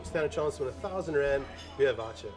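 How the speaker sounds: normal talking voice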